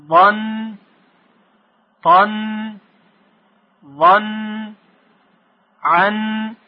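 teacher reading letters aloud for class to echo; teacher recitation. Shown as a demonstration. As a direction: chant the letters with tanvin for pupils to repeat.

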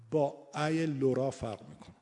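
ordinary talk

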